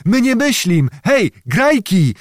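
high, eager shout; calling out. A narrator's reading. Old man summons the wedding musicians, calling out high. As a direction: holler to summon the band. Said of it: "zakrzyknął na muzykę".

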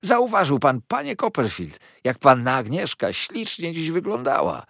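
high, loud, eager gushing; fawning Heep. Acted. Heep asking about Agnes's looks, gushingly. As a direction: press eagerly for agreement.

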